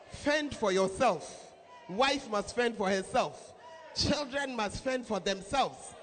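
each fend hit hard and slow; slow emphatic preaching. Real, not acted. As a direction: drive the phrase home, slowly. Preacher hammering a phrase.

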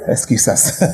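ordinary delivery

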